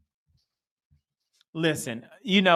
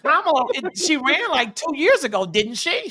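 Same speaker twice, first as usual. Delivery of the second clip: mocking clueless friend; 10 semitones higher, 3 dB louder than usual. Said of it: "He was like".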